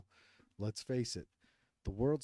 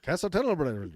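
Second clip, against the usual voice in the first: "Castleton Burr" louder with a rolled Scottish r; rolling the r in "Burr" like a Scot; Scottish-leaning accent; 6 semitones higher, 8 dB louder than usual. Shown as a demonstration. Host reads the place name in a rolled Scottish accent. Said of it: "That, that, that went Scottish".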